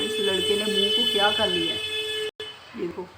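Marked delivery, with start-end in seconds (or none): 0.0-1.8 shocked fussing. Real, not acted. Fussing at parrot.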